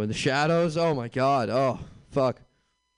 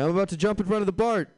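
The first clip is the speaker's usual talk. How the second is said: much higher, faster, louder; prank caller voice; 8 semitones higher, 3 dB louder, faster than usual. Retold or imitated. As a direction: rush it out in mock panic. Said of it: "They'll say".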